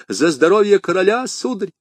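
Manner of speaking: very high, pleading servant; high-pitched, anxious self-defence; flustered excuse. Acted. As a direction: make frightened excuses to your master. Scared servant excuses himself to master.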